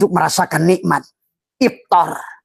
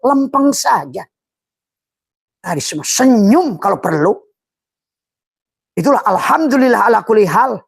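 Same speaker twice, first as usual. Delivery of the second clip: preaching at full force; 7 semitones higher than usual. Real, not acted. Preacher urging listeners to stay steady. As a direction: press the point home passionately. Preacher rises to fervent pitch.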